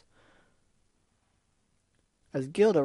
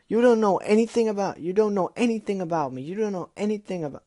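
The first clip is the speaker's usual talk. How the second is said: desperate outcry; 7 semitones higher, 6 dB louder than usual. Acted. Dash cries out.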